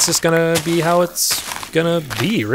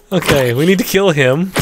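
ordinary talk

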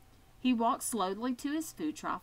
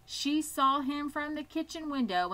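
plain reading voice

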